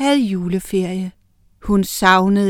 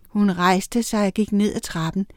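usual speaking voice

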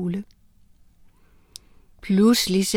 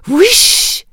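whoosh sound; 10 semitones higher, 8 dB louder than usual. A narrator's reading. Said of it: "sagde det".

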